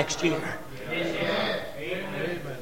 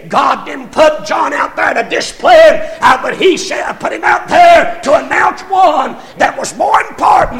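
loud, high preaching; full-force preaching; 7 semitones higher, 6 dB louder than usual. Real, not acted. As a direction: climb to a shouted peak.

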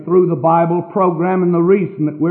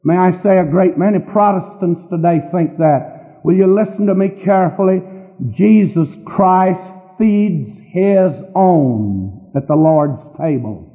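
normal talking voice

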